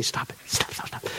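hushed, whispered interjection; mock whispered heckle. Mimes how listeners should object.

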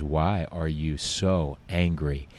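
very slow, low and soft; retold counselor, unshakeably calm and hushed; calm, deliberate repeat. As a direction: press the question quietly, word by word. Counselor repeats question after her protest.

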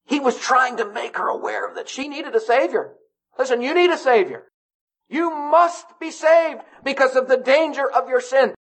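high, insistent gospel appeal; urgent preaching. Real, not acted. Preaches at full force.

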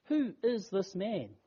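ordinary delivery

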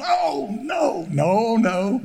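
high, shouted no no no; emphatic refusal. Retold or imitated. Preacher refusing the friend's car, emphatic no.

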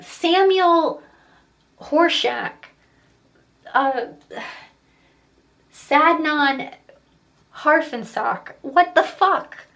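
put-on loud, high-pitched exasperated kid retelling; frustrated child. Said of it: "And I got so frustrated".

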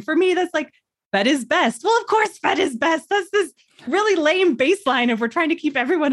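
high-pitched retold rant; indignant venting. Mother re-enacts venting to husband and doula, high indignant rant. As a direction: rant indignantly without really yelling. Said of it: "yelling at my husband and the doula".

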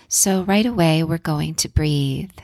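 calm guided breathing voice. Acted. Leads a breathing exercise.

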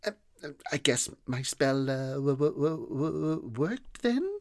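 high, halting, stuttering voice; shy stammering boy. A narrator's reading. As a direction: stammer it out shyly. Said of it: "with a shy smile said".

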